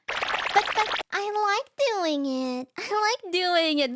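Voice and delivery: high, slow whiny voice; mock whine from the mom defending her driving; whiny mother. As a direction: pout it out like a sulking kid. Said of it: "she replied with".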